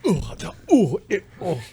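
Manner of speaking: playful high ooh noises; hammed-up ooh sounds; mock excited cooing. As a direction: coo it like a teaser clip. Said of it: "you need to play that".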